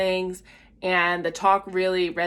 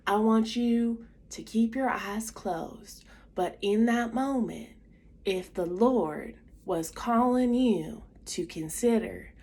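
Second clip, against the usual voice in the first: put-on strong Southern accent; 2 semitones higher, 4 dB quieter than usual. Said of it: "he had a strong Southern accent".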